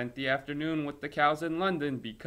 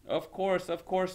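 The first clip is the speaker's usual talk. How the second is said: cheerful welcome; 7 semitones higher than usual. Acted. Cows welcoming English children.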